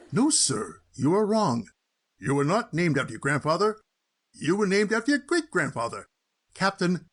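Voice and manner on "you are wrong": normal talking voice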